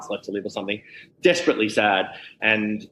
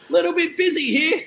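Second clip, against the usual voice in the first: much higher, laughing put-upon protest; mock exasperation. Comic telling snake handlers he's busy, high mock exasperation. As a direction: laugh through a mock-indignant complaint. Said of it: "I'm like".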